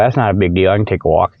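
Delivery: usual speaking voice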